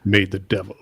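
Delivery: a hint of low trailer voice; movie trailer voice. Shown as a demonstration. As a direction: deliver it like a trailer, lightly. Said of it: "movie trailer voice".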